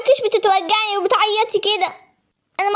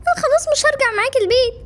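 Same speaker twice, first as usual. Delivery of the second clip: put-on high girl's voice near tears; tearful pleading; 4 semitones higher than usual. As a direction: push your brother away while crying. Infected sister pleads.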